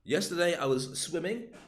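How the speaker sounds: Yesterday lifted and slowed, rising; stress on yesterday. Shown as a demonstration. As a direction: lean on Yesterday. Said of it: "That would all emphasize".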